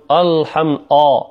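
"الحم" opened with a dark, thick "a"; heavy hamza. Shown as a demonstration. Teacher shows a common mistake, heavy hamza in "الحم". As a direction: make the first vowel of "الحم" heavy and back. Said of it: "تفخيم ال-، الهمزة".

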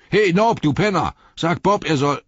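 loud, high yell for the servant; brusque innkeeper's shout; calling out. A narrator's reading. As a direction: holler an order across the inn. Innkeeper calls his servant across house.

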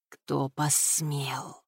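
very slow, low and soft threat; menacing challenge. A narrator's reading. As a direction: draw out each word with quiet menace. Enraged captor challenges the shouter.

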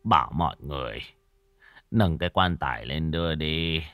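put-on low, soft, worn-out old father; spent, resigned murmur; weary and dejected. A narrator's reading. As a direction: wave them off in a tired low voice. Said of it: "Lão chán nản phất tay".

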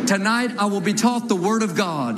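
slow, measured call-and-response declaration; unison Bible confession. Acted. Preacher leading the church in a slow confession. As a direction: declare each line slowly for the church to repeat.

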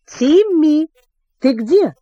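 storybook calling-out voice. A narrator's reading. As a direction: call into an empty hole, narrator's restrained shout. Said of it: "Джулиан крикнул в пустоту".

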